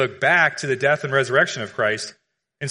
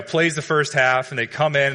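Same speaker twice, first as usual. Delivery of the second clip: ordinary talk, nothing marked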